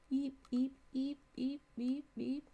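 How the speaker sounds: soft, high little beeps; silly beeping. Pretend. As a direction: beep softly in a silly high voice. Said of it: "I'm being silly now".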